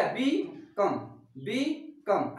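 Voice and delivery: "बी", pause, then "कम"; split syllables. Shown as a demonstration. Teacher showing the two syllables, "बी" and "कम" split by pauses. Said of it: "कम अलग हो गया".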